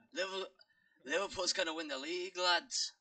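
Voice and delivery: put-on Scouser football boast; Scouse accent. Shown as a demonstration. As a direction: cheer on the lads in a Liverpool accent. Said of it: "he says you can do British accent".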